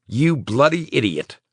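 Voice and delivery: high, affectionate scolding; fond exasperation. A narrator's reading. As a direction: scold him with relief. Relieved friend, teasing.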